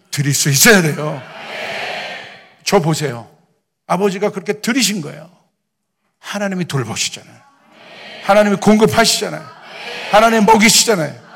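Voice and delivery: loud sermon climax, rising in pitch; impassioned preaching. Real, not acted. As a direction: drive each line home to the congregation, building to a shout. (점점 크고 높아지는 힘찬 설교)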